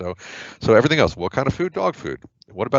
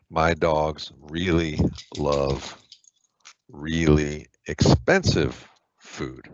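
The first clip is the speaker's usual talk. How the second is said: slow, deliberate model sentence; teacher spelling out an example sentence; slow example read; 3 semitones lower, much slower than usual. Shown as a demonstration. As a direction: read it out slowly, word by word. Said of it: "example sentence read slowly".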